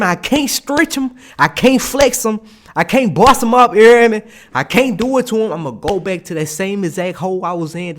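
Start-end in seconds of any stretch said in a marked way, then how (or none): 0.0-5.5 higher, louder, punchy list of complaints; exasperated protest. Retold or imitated. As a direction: push each item up in frustration. Lists what he cannot do.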